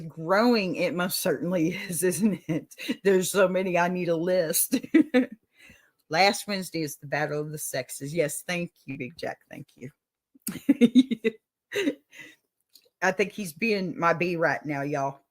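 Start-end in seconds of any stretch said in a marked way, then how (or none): 4.8-5.3 a high, loud burst of laughter; short high laugh at forgetting the show list; bright loud laugh. Real, not acted. Laughing at her own mix-up. 10.5-11.3 loud, higher laugh; quick laugh thanking Big Jack for the prompt; amused laugh. Real, not acted. Laughing at Big Jack's help.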